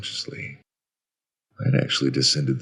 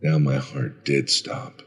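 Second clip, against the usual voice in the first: usual speaking voice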